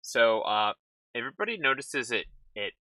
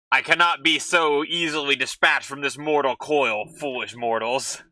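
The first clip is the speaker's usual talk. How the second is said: booming demon lord; 5 semitones higher, 5 dB louder than usual. Acted. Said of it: "And he's like".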